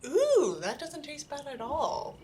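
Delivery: ordinary delivery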